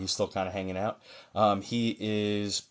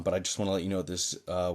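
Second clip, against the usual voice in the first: ordinary talk, nothing marked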